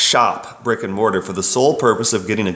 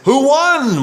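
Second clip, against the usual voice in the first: much higher, louder, eager mock parent; parent asking kids; 4 dB louder than usual. A parent greets kids after soccer, mock eager and high. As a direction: chirp it like a keen parent. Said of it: "ask our kids".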